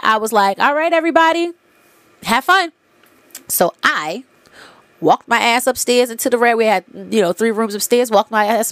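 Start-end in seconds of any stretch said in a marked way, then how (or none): 0.6-2.7 calling out. Retold or imitated. Hostess calls out to party.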